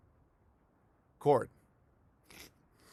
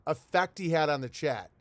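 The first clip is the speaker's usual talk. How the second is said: ordinary talk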